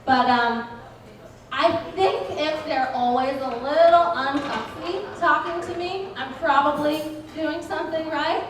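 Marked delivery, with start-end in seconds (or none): none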